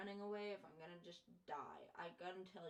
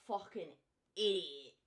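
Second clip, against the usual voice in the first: louder, self-mocking yell of idiot; 11 dB louder than usual. Real, not acted. Streamer yelling idiot at herself. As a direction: bark the insult at yourself.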